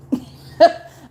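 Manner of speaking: sudden loud burst of laughter; loud high laugh. Real, not acted. Laughing at the hypocrisy she recalls, loud burst. Laughs at her own outrage.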